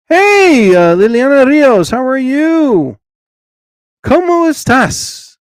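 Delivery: high, loud hello to each viewer; big cheerful shout-out; excited greeting. Real, not acted. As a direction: call out names with glee.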